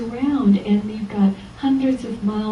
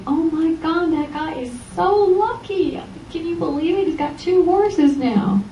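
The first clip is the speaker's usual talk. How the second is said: much higher, amazed gossip, slowing at the end; gossiping neighbors; 7 semitones higher than usual. Retold or imitated. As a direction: gasp like an envious villager. Neighbors gossip about lucky farmer.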